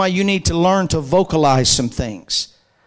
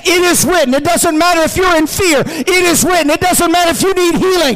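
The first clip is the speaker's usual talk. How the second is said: high, insistent chant-like preaching; rallying preaching; 7 semitones higher than usual. Real, not acted. Preacher has congregation say "It is written", rallying and insistent. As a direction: drive the refrain harder each time, climbing in pitch.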